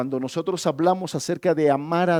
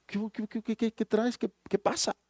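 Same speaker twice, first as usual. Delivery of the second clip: softer, higher stammered qué qué qué; startled pastor; 5 semitones higher, 7 dB quieter than usual. Acted. Pastor startled by the man bursting into his office, stammering. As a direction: caught off guard, stumbling over the question. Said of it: "el pastor se queda sorprendido".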